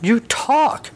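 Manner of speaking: high shocked shriek trying to sound manly; a cowboy shrieking at a talking horse. Acted. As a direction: squeal it out but keep it macho. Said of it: "Tux shrieks in a manly way".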